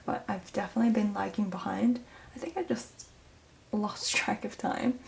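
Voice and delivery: soft whisper to avoid waking someone. Real, not acted. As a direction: whisper it, mum's asleep. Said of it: "I'm talking quiet 'cause I don't wanna wake up my mum".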